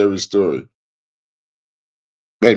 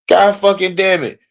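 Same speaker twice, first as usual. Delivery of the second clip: ordinary talk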